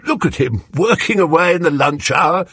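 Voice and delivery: lightly voiced bluff, jolly neighbour; a hint of a back-slapping bore; hearty Parsons. A narrator's reading.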